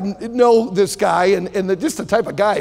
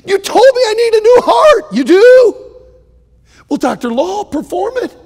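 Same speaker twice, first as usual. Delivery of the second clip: frantic demand; 11 dB louder than usual. Acted. Lester demands surgery.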